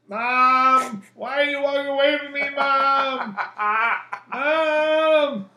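loud, high mock-whiny calls after mom; goofy calling out. Son calling after his walking-away mom, loud and high. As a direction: holler after her to embarrass her.

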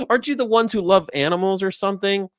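high mock-curious question; clueless member of the public. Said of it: "other fifty percent are like".

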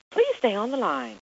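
phone menu voice. Retold or imitated. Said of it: "calling, like, you know, my cable company".

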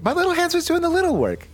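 squeaky-high little-hands voice; tiny high voice. Acted. Vern explaining the extra hands, comically high. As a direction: jump way up in pitch for the small hands.

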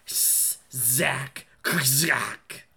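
whispered crackling zap noise; electric zap sound effect. Shown as a demonstration. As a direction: hiss out a sharp electric zap. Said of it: "is how you would do those, those sound effects".

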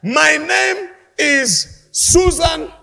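loud, slow, proud mock declaration; confident name announcement. Woman declaring her name at heaven's gate, loud. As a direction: announce the name grandly to the angel. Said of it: "you say".

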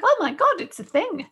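high, bright rush of discovery; excited surprise. Retold or imitated. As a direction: gasp it out as a delighted find. Retelling discovering Honegar in shop.

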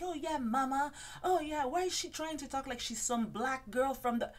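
high, sassy put-on voice with an oh yeah; mock Ramona voice. Ramona mocking Bethany's talk to a friend at the bar, high and sassy. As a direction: sneer it like a gossiping diva. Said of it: "She's like".

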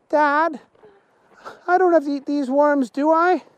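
put-on high-pitched child's voice, pleading; high kid voice; worried young son. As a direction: ask dad to let you off, in a high voice. Son Alexander pleads with his dad.

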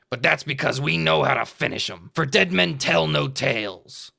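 pirate voice. Acted. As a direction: savor the threat, pirate style. Pirate speaking.

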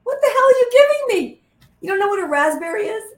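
high, loud disbelieving outburst, a bit fast; incredulous yell. Retold or imitated. Producer yelling at the actress over her raspberry. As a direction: bellow it like a baffled producer. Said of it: "he goes".

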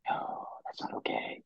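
hushed, breathy no to himself; whispered refusal. Retold or imitated. Remembering a friend's death, whispered denial. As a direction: whisper it like a stunned kid.

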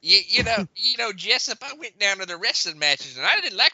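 put-on folksy southern yarn in Mississippi accent. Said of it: "Mississippi accent".

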